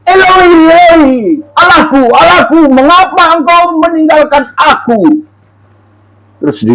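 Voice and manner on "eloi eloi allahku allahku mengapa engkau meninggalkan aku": high, slow anguished cry; the forsaken cry from the cross. Acted. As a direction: call out to God in anguish, drawn out. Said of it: "tadi kan berseru-seru".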